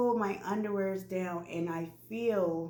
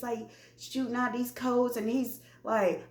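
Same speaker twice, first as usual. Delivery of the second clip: usual speaking voice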